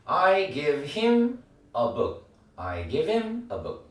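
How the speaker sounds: very slow, stress on him; slow drill. Shown as a demonstration. Teacher drilling him slowly. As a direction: stretch it and land on him. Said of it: "그래서".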